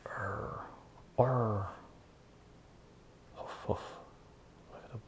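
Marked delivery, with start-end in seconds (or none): 0.1-1.7 imitated bull bellow. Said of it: "the sound effects of a bull". 3.3-3.8 softer, voiceless huffs; imitated bull snort. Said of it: "the sound effects of a bull".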